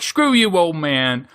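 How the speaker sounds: high, loud scoffing retort; shouted cartoon sass; mock defiance. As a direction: hurl it at the old barkeep. Couple defying the barkeep again.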